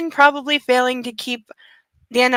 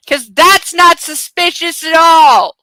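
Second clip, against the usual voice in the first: loud, slow mock innocence; drawn-out sarcastic jab, louder; 6 semitones higher, 9 dB louder, slower than usual. Real, not acted. Streamer mocking the book's hero, loud and sarcastic. Sarcastic aside while reading.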